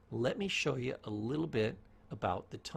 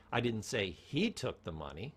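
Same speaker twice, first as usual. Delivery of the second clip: he stressed; 3 semitones lower than usual. Shown as a demonstration. Teacher demonstrating tone, stress on he. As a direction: punch the word he. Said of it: "change the tone".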